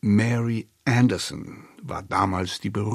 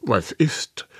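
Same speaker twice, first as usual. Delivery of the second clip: plain reading voice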